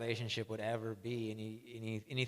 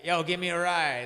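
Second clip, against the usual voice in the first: blunt request; 5 semitones higher, 9 dB louder than usual. Retold or imitated. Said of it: "They can't be like".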